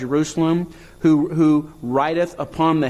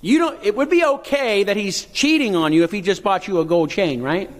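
normal talking voice

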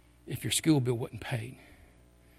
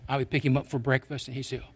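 ordinary talk, nothing marked

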